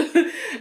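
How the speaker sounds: quick high chuckle; real short laugh. Real, not acted. Laughs after remark.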